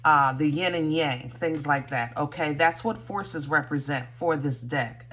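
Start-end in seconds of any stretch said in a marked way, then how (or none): none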